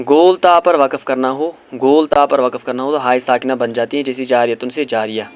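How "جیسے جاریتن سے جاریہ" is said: usual speaking voice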